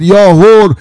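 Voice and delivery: loud, high, stretched-out proclamation; heavenly voice. Acted. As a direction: call out glad tidings from on high. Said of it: "یه بار دیگه این صدا رو شنید".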